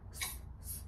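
hissed "S, S, S" unvoiced like a snake; s sound. Shown as a demonstration. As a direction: stretch each "S" into a hiss. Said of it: "S is for star".

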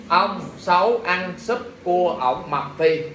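slow, word by word; spaced-out mnemonic, each word separate; slow dictation. Shown as a demonstration. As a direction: dictate it slowly for note-takers. Dictating mnemonic for students to write.

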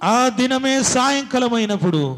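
high, loud fervent preaching cry; loud, high revival preaching; preaching at full force. Acted. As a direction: call the church to prayer with everything you have. Preacher echoes scripture reading.

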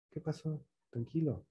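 soft, hushed calming tone; calm soothing reply. Shown as a demonstration. Coach showing the wrong, hushed calming reply to an upset person. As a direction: play it down quietly, like telling someone to relax. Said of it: "No le contestes así como en un tono de".

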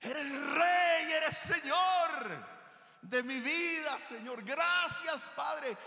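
loud, high, very slow praise cry. Acted. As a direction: cry out to the Father with all you have. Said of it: "Ese es ese clamor".

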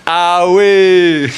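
high, slow, loud call of the strict teacher; Ghanaian teacher. Retold or imitated. Teacher sending the day students home, loud call. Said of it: "this is always what he says".

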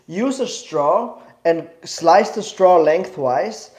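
ordinary talk, nothing marked

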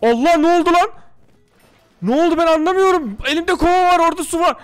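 high, loud, baffled outcry; frantic disbelief. Real, not acted. Gamer yelling at the game in frantic disbelief. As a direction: complain loudly that the game cheated. Confused after falling down.

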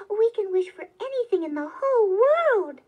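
high, loud wonder; thrilled exclamation swelling toward the end; very excited. A narrator's reading. Wife marvels at the wishes to her husband, very excited. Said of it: "She became very excited".

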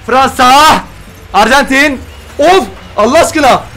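loud, high bursts between pauses; excited calling out. Real, not acted. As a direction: shout each clue as it appears. Calling out pack reveal clues. (heyecanla bağırma)

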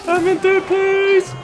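high, slow triumphant yell; excited shout. Real, not acted. Bragging about scoreboard.

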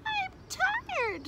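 very high tired whine; complaining young frog. A narrator's reading.

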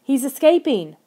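high, loud, mild shriek. A narrator's reading. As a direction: shriek an alarm, slightly restrained. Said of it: "I shrieked".

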